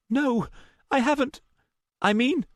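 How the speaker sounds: high, agitated denial; upset, high-pitched and halting; flustered distress. Acted. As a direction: falter anxiously in a high voice. Luna fighting back tears, flustered.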